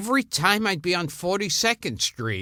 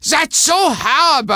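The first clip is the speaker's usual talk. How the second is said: put-on high, loud, outraged scolding in an older woman's voice; mock-horrified high yell; scolding shout; 11 semitones higher, 6 dB louder than usual. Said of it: "And she goes".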